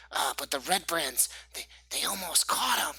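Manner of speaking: put-on high-pitched child passing on a secret; eager young boy Pip. Game master plays Pip, a boy.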